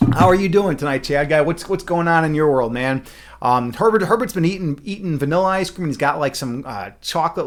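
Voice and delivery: ordinary delivery, nothing marked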